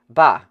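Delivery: loud scornful hawk and spit; a loud contemptuous spit at the man; loud spitting bah. Acted. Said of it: "Hua Di hawked a glob at him".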